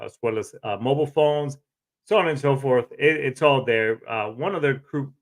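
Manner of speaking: ordinary talk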